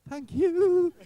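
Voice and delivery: very high, starstruck little voice; nervous squeaky thank you. Retold or imitated. Starstruck actor thanks his idol, squeaky high voice. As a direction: squeak it out like a frightened boy. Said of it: "I was like".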